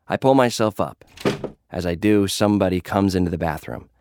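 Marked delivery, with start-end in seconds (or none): none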